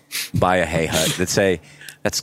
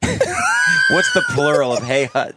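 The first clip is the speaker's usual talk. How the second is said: very high, slow, hammed-up yell; mock shout; much slower than usual. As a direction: belt it out in a silly shriek. Mock loud outburst.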